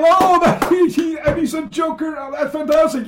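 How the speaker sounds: high, loud laughing delight; delighted outburst. Retold or imitated. The audience's reaction retold, high and loud with delight. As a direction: burst out with joy at the joke.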